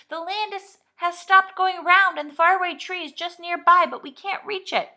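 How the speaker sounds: loud, high excited shout from below. A narrator's reading. Rabbit shouting up from the hole to the children, loud and high. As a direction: call up the news at full voice. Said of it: "gave a shout".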